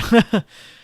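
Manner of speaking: high loud laugh; loud burst of laughter. Real, not acted. Laughs at joke.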